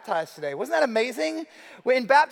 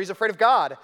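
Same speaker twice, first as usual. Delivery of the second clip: usual speaking voice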